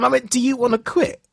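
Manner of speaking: ordinary delivery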